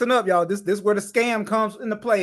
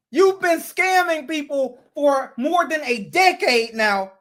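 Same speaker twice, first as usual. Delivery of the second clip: higher, slow and heated; slow angry accusation; 6 semitones higher, 3 dB louder, slower than usual. Real, not acted. Commentator accusing the scammer of years of fraud, slow heated accusation. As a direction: spell out the accusation with rising anger.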